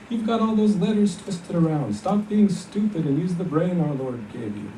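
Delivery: put-on high, nagging older sister; scolding sister. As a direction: scold him sharply, pitched high. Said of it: "She used to cuff me".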